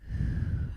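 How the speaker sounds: long audible exhale; long sigh. Real, not acted. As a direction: let out a big breathy sigh. Long breath out between meditation steps.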